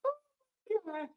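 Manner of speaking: high, soft wail through laughter; can barely hold yourself together from laughing; real breathless giggling squeal; helpless laughter. Real, not acted. Overcome with laughter, cannot hold it.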